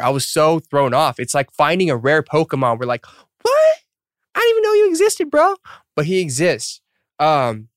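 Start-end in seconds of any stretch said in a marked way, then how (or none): 3.4-5.5 very high, squeaky disbelief; mock shock. Startled at finding another English-speaking member, mock shock. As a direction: squeal it in hammed-up astonishment.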